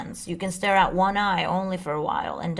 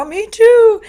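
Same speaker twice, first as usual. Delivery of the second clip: much higher, much louder excited "me too"; delighted squeal; 13 dB louder than usual. Retold or imitated. Retelling meeting Stardust at the drum circle, excited squeal. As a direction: squeal it like you just found your twin.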